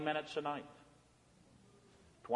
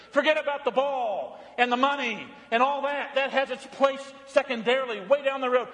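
higher, fervent pulpit preaching; preaching at full force; 7 semitones higher than usual. Acted. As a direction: preach it out to the whole church, full voice. Preaches to the congregation.

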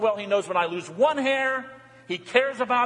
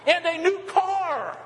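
higher, slower, savouring "new car"; delighted; 10 semitones higher, slower than usual. Real, not acted. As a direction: relish the dream gift, voice lifting. Reading wishes, delighted at car.

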